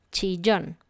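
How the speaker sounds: "Chillón" said alone, clear, falling at the end; modelled word. Shown as a demonstration. Teacher models the new word, clearly. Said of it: "La siguiente palabra es chillón".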